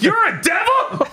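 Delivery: very high mock freak-out. Bat freaks out at losing an arm, hammed-up alarm. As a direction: squeal it in exaggerated alarm. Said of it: "Bat freaks the fuck out".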